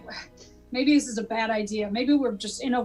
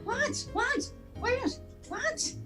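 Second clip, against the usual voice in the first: high confused; 11 semitones higher, 7 dB quieter, much slower than usual. Acted. Confused, searching for voice.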